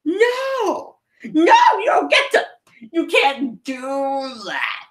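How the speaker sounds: a sore-loser Frieza refusing to accept it; imitating Frieza. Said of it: "He's just, he's just like".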